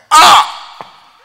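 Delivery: a loud, high exclamation; preacher's whoop at his own story; loud preacher's cry. Real, not acted. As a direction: cry out in excitement. Preacher cries out.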